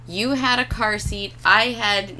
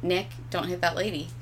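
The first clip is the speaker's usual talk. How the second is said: gentle warning; 2 semitones lower, 8 dB quieter than usual. Shown as a demonstration. Wife showing husband how she warns him while driving, gently. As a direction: say it softly and calmly, barely raising an alarm. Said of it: "gently say".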